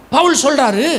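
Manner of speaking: usual speaking voice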